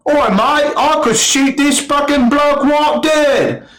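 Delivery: high-pitched mock British soldier; attempted British accent. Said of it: "I can't do a British accent, only Australian".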